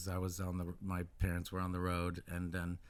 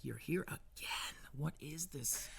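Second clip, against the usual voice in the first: higher, softer, half-whispered disbelief; mock bewilderment; 6 semitones higher, 6 dB quieter, slower than usual. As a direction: play a boy puzzled by such constancy. Retold boy woken by grandmother.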